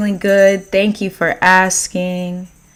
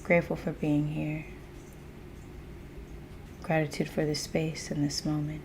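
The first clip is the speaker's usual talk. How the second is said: low, hushed, calm voice; soft meditative opening; 4 semitones lower, 17 dB quieter than usual. Acted. Host opening a live session with gratitude, soft and meditative. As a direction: open the session softly and lower, letting each phrase settle.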